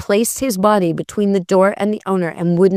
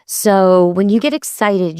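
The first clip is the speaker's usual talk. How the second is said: ordinary talk, nothing marked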